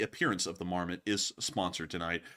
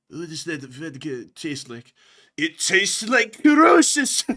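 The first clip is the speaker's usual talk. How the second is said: mock Russian soldier; 4 semitones higher, 8 dB louder, slower than usual. Repeating the vodka joke.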